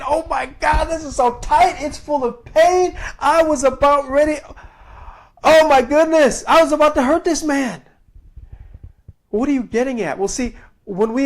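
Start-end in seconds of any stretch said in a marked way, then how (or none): 0.1-3.0 mock pained cry. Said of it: "I'm thinking". 3.2-7.8 rising, loud and high, worked-up retelling; preaching at full force. Real, not acted. Preacher retells the painful therapy stretch, loud and high.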